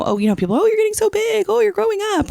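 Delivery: put-on high, gushing adult cooing over a child; gushing grown-ups. Everyone fussing over how much he has grown, high and gushing. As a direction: coo it like an aunt marvelling at how big he got. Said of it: "everyone's told him".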